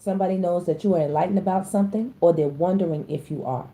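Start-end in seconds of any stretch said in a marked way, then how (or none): none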